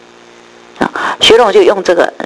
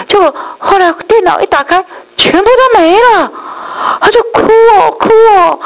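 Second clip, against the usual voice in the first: retold crying; 13 semitones higher than usual. Telling the church how the pastor cried, in a high tearful voice. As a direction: voice rising into a mock sob as she retells it.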